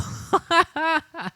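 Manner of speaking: short high laugh; high giggle. Real, not acted. Streamer giggling at a birthday joke.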